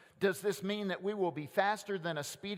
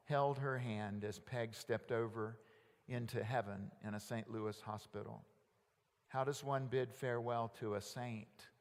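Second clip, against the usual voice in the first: low, subdued and grave; solemn mourning; 5 semitones lower, 4 dB quieter than usual. Real, not acted. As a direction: gently lower the voice for a farewell. Telling of a church member's death.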